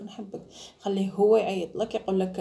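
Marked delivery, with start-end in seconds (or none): 0.0-0.4 quiet whispered نحبك; soft mock i love you. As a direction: murmur نحبك tenderly.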